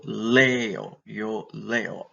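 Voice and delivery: leo with LE drawn out and punched, O light; stress on first syllable. Shown as a demonstration. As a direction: lean on the LE in leo, two clear syllables. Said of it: "put the accentuation on the first syllable".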